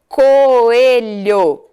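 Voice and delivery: COELHO with a long, loud CO; stress on first syllable. Shown as a demonstration. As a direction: lean hard on the CO of coelho. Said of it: "Sílaba inicial".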